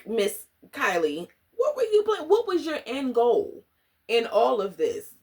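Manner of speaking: high, pushy mock interrogation; sassy demand. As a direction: press her for an answer, all sass. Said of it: "And I was like".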